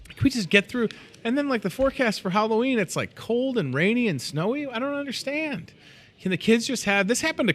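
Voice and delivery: high, pleading whine about the snow; whiny complaint. Real, not acted. Host whining to listeners about early snow, high and exasperated. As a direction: complain like nobody's ready for this. Host dreads snowy Halloween forecast.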